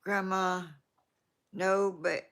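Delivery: ordinary delivery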